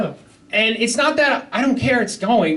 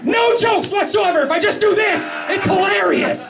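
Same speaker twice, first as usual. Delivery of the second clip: hammed-up shout; 12 semitones higher than usual. Comic shouting to friends about his bit, high laughing yell.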